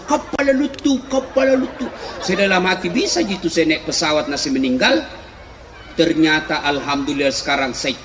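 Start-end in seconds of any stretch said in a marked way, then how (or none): 0.0-1.9 high-pitched, chanted mock call; retold Bugis call. Bugis villager pointing out a plane, high mock yell. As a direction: imitate calling out to a passing plane, no real volume. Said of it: "saya teriak".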